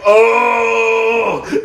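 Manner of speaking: high, loud startled cry; hammed-up wow; mock impressed oh. Sandman watching the brawl, a loud impressed cry.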